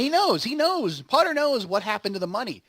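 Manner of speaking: rising emphatic excitement over the twist; excited. Real, not acted. Excited retelling of the plot.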